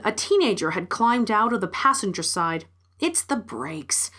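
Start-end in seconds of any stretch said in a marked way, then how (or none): 3.0-4.1 low, slow, soft mutter. A narrator's reading. Teenager explains the car crash, low slow mutter. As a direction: mutter it under your breath, unhurried. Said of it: "she muttered".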